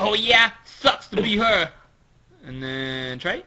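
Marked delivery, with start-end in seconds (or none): 0.0-1.7 loud, higher, dismissive reply; Frankie. Acted. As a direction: brush it off loudly. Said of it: "Frankie says".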